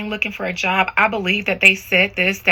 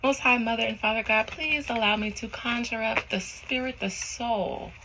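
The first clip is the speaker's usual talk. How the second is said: soft, measured invocation; prayer; 3 semitones higher, 6 dB quieter than usual. Real, not acted. Reader asking God to conjure a spirit, soft prayer. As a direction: pray softly, drawing out key words.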